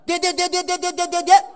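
knocking cry. Retold or imitated. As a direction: tap out each dian evenly like knocks. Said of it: "我三口吃不完呢。".

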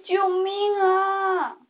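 slow, drawn-out high call for help; shouting hard. A narrator's reading. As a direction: stretch the cry out long. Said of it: "使劲儿地喊着". (使劲拖长呼喊救命)

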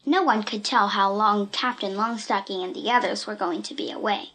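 usual speaking voice

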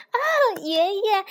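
high, loud little toad; excited cry. A narrator's reading. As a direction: call out to Grandpa in admiration. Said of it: "他叫道".

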